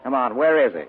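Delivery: hard, loud demand; demanding shout. Acted. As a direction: lean on him, loud and sharp. Spade pressing the tailing boy.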